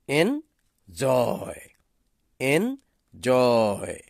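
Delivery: Enjoy said slowly with the weight on এন; slow stressed enjoy. Shown as a demonstration. Teacher repeats enjoy slowly for the class. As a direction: drag out enjoy, leaning on its first syllable. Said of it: "যেমন".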